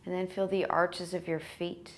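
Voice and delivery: soft, spacious breath-counting voice with long pauses; guided meditation. Real, not acted. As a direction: lull them gently toward sleep, leaving space to breathe. Leads breath count and body scan.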